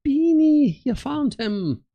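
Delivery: high-pitched, loud squeal on the name, then drawn out; squealed, high and drawn-out discovery; excited cry. Acted. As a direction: cry out high and stretch the words. Voices a game character's delighted cry.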